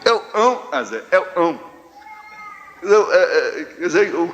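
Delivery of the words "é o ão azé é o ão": high-pitched "ão" for cão; dropped consonant. Retold or imitated. Great-aunt answering grandfather, saying "ão" without its consonant. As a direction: call out "ão" with the first sound swallowed. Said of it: "Ela não falava cão".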